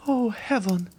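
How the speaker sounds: put-on high, pleading young woman's voice; a terrified girl refusing to believe; frightened young girl. A frightened young Valentine begging the Count to leave her.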